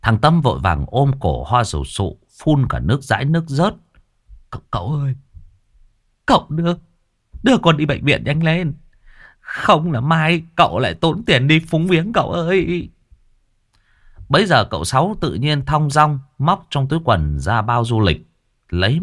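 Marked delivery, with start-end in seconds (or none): 4.5-12.9 high whiny plea; pleading young man. Acted. Tâm begs uncle to take him to hospital, high pleading. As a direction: beg to be rushed to hospital, pitched up.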